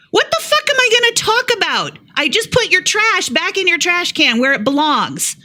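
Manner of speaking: high, exasperated retort, pitch way up; exasperated outburst. Retold or imitated. Retelling snapping back at the accusing neighbor, high incredulous outburst. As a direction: fire it back at her in disbelief. Retold argument with rude neighbor.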